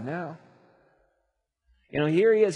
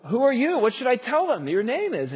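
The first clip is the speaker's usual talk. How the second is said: anxious questioning; 9 semitones higher, 3 dB louder than usual. Acted. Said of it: "And Moses says".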